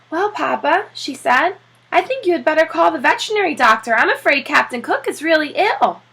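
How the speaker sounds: urgent worry about a sick pet, rushed; worried wife. Acted. Wife urging husband to call the vet, anxious and fast.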